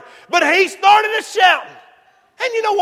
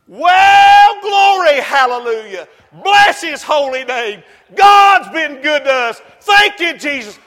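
bursting into praise. Real, not acted. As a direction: break out rejoicing in the pulpit. Preacher breaks into praise.

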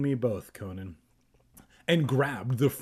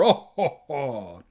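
roaring laugh; 5 dB louder than usual. Acted. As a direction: fake a big hearty laugh. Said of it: "roared with laughter".

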